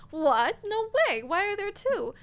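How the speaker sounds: disbelieving, jumping high on "No way"; genuinely thrown, voice leaping up; surprised. Real, not acted. Learns there are two arches.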